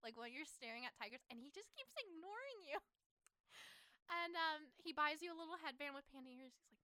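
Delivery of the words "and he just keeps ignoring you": ordinary talk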